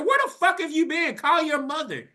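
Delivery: high, loud angry yell; furious big sibling yelling; angry scolding. Retold or imitated. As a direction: chew him out at the door. Confronting brother at door.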